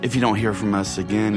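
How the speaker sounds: ordinary delivery, nothing marked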